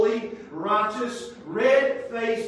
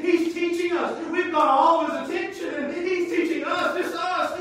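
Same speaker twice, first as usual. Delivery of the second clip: mock proud disciples; 8 semitones higher, 4 dB louder than usual. Disciples voiced proudly in sermon.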